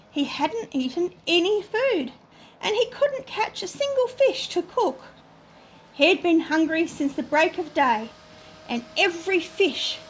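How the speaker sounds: plain reading voice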